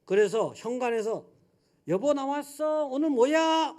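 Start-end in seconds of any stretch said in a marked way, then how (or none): 1.9-3.8 higher, sweet call from the doorway; tenderly calling wife. Retold or imitated. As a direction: call out affectionately to the kitchen. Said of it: "아주 다정하게 불렀어요". (현관에서 아내를 다정하게 부르며)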